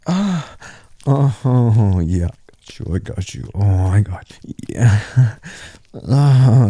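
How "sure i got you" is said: reassuring whisper. Acted. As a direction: hush and reassure her. Soothing her, then repeated aloud.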